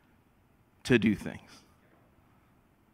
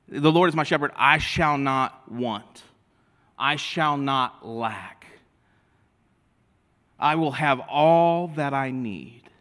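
slow reading; slower than usual. Acted. Psalm read slowly.

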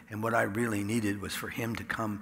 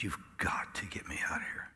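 whispered plea; 5 semitones lower, 8 dB quieter than usual. Retold or imitated. Desperate plea to God.

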